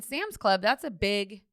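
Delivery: normal talking voice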